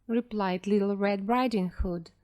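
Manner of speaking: ordinary delivery, nothing marked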